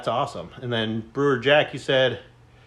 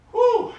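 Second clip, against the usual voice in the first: loud high exhale; 4 dB louder than usual. Real, not acted. Exhale after a heavy set.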